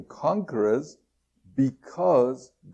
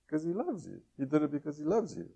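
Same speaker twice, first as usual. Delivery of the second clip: tender reassurance; 4 semitones higher, 9 dB quieter, faster than usual. Acted. Preacher assures the congregation.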